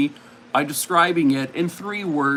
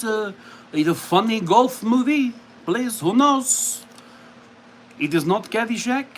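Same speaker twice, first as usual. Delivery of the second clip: higher-pitched, hammed-up French accent; 5 semitones higher than usual. Hosting a movie guessing game for viewers, mock French accent. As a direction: play a cartoon French quiz host. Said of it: "I should not have done the accent".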